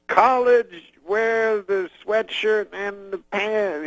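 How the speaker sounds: high, slow Cosby advice in fragments; Cosby lecturing the comic about college clothes, high and slow; Bill Cosby impression. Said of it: "he doesn't use full sentences".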